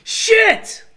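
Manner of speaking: shocked yell. Real, not acted. Gamer caught off guard in the game, yelling. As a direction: blurt it out in shock.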